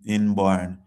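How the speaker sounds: usual speaking voice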